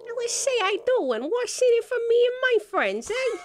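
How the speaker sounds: high, loud street-wise rat; a cocky squeaking rat; squeaky rat voice. Acted. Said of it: "Is that the rat's voice?".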